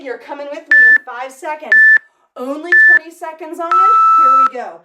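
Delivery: hyped countdown shout; loud call. Real, not acted. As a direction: fire up the class. Coach hypes the start of the interval.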